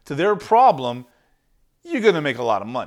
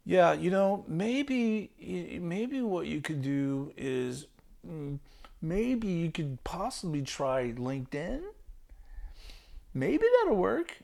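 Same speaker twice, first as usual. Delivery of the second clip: much softer, slow, hesitant voice; wimpy tone; 3 semitones higher, 7 dB quieter, slower than usual. Shown as a demonstration. A caller unsure of his own advice, meek and hesitant. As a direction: shrink away from committing to anything. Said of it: "I'm trying to be a wimp here on the call".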